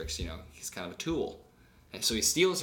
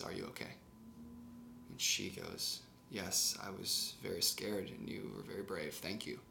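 soft voiced dialogue; 3 semitones lower, 10 dB quieter than usual. Quoted knight and heroine dialogue.

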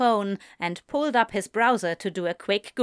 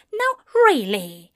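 high, louder, huffy angel; fussy angel scolding, pitched up; annoyed Aziraphale; 13 semitones higher, 6 dB louder than usual. Acted.

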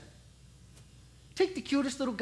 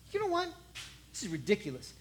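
usual speaking voice

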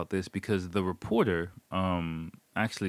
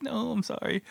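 high apologetic mock-lady voice; lady refusing. Staff lady refusing the hiker, high-pitched apologetic imitation. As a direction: turn him down in a high put-on voice.

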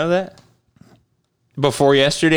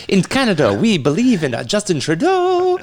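mock Canadian accent; 4 semitones higher than usual. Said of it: "They speak like this in Canada".

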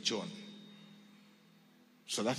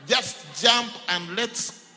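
devil urging loudly; 6 semitones higher, 9 dB louder, slower than usual. Retold or imitated. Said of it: "the devil is like".

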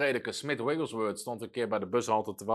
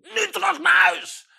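much higher and louder, slow furious yell; angry shout; 9 dB louder than usual. Retold or imitated. Old lady yelling at her dog, angry shout. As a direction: bellow the order at the dog. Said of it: "die stampt met de voet ... die schreeuwt".

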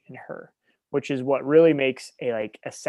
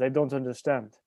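put-on boy's Russian accent. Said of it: "He says".

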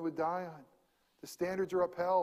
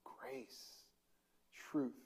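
whispered; 5 semitones lower, 13 dB quieter than usual. Real, not acted. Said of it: "let that go into your soul".

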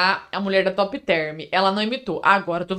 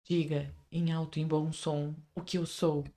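hushed, slow, softer dramatic line; Edward line; 6 semitones lower, 12 dB quieter than usual. Acted. Cueing the friend as Edward, slow hushed drama. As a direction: breathe it out like a brooding vampire. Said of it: "agora eu vou falar minha fala".

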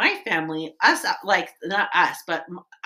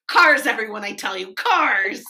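salesy hype; 2 semitones higher, 9 dB louder than usual. Retold or imitated. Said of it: "she's like".

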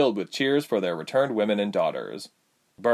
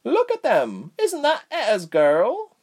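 high, excited woman's voice; 4 dB louder, slower than usual. A narrator's reading. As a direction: point and marvel, high and eager. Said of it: "clutching his sleeve excitedly".

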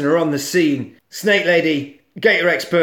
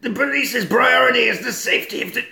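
high strained police chief voice; struggling chief impression; walrus chief voice; 8 semitones higher, faster than usual. Shown as a demonstration. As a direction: push the voice up and pompous. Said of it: "his voice is really hard to do".

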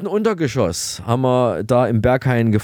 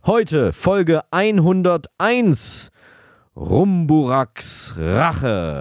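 very slow, weighty episode title; dramatic title; 4 semitones higher, much slower than usual. Acted. Host announcing the episode title like a film trailer.

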